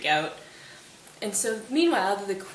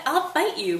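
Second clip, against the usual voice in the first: higher and louder challenge; raised challenge; 7 semitones higher, 4 dB louder than usual. Retold or imitated. Hamlet challenging Laertes at the grave, raised voice. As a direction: throw down the gauntlet, "I'll fight you" raised but not yet yelling.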